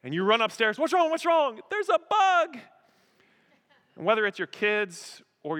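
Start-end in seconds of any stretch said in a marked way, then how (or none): 0.8-1.6 high, loud panicked questions; hammed-up worried parent; mock alarm. Acting alarmed parent rushing upstairs. 1.7-2.6 hammed-up high, loud panic; mock-terrified scream. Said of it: "you hear screaming".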